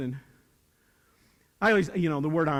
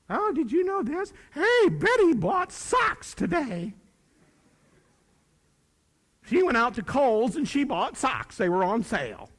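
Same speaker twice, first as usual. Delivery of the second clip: high, chattering imitation of wife; mock gossipy wife; 7 semitones higher than usual. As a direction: play the excited wife sharing gossip. Wife reading gossip from twit face.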